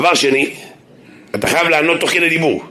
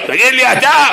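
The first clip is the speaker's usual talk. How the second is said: high, loud dybbuk-like shout; loud mock dybbuk yell; demonic yell; 10 semitones higher, 4 dB louder than usual. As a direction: blast him with a possessed voice. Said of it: "יש לי קול של קולורבי עם חולות".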